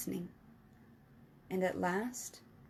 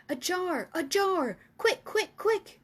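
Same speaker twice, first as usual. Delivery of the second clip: much higher, louder urgent calls; 9 semitones higher, 4 dB louder, slower than usual. Acted. Giant urging Sophie to pass a jar, high and urgent. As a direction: hurry her, pitch climbing.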